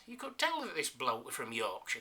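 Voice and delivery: ordinary delivery, nothing marked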